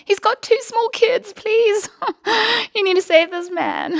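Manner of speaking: high, loud desperate plea; imagined shout. Retold or imitated. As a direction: shout for help, laughing as you retell it. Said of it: "I wanted to shout to them".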